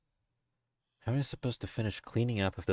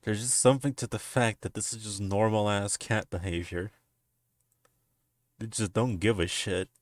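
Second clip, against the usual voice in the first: ordinary delivery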